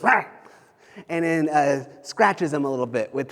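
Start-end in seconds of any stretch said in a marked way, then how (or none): 0.0-0.2 short, sudden dog snarl; snippy pug snarl. Retold or imitated. Preacher imitating his pug lashing out, snippy snarl. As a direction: snap out a little pug's "rah". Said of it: "gets a little snippy and goes".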